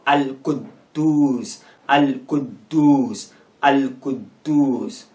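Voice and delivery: "Al-Quddus" slowly broken into Al-Qud, then dus; very slow "Al-Quddus" with a gap at the double D; silent first D. Shown as a demonstration. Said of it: "as if the first D is silent".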